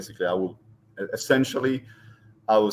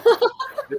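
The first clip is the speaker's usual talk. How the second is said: burst of laughter; 6 dB louder than usual. Real, not acted. Laughing at the dog.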